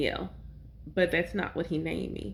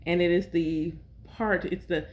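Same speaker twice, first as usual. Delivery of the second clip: ordinary delivery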